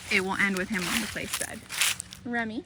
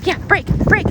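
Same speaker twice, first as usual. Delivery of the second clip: high, loud playful release; excited release; 9 semitones higher, 8 dB louder, faster than usual. Real, not acted. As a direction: let him loose with glee. Releasing dog to play.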